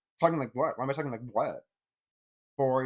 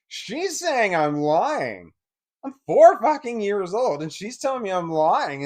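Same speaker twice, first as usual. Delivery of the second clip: loud, heated outburst at the memory; real anger; 8 semitones higher, 11 dB louder, slower than usual. Real, not acted. Angry retelling of being called liar.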